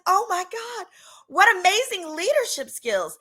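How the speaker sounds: high, starry-eyed praise; gushing admiration. Retold or imitated. She praises the dealer to her friends, high gushing. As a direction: gush over his leadership skills. Mock admiration of drug dealing.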